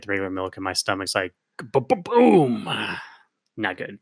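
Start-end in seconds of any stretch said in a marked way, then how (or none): 1.6-2.6 slow drawn-out mock tummy boom; stomach rumble noise. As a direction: voice the gurgling stomach. Stomach reacting to regular milk.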